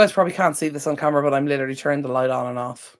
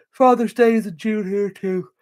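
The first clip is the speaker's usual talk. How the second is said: slow, louder read-out for the audience; chat read aloud; 5 semitones higher, 5 dB louder, slower than usual. Real, not acted. Streamer reading a viewer's comment out to chat, slow and clear. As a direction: announce the comment clearly to everyone.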